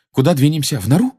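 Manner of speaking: put-on high-pitched boyish hope; hopeful question. A narrator's reading. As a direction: ask hopefully, lifting the pitch. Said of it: "поинтересовался Гарри с надеждой".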